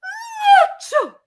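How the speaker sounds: very high, loud sharp intake before a sneeze; sneeze gasp. Acted. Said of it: "One more time, guys".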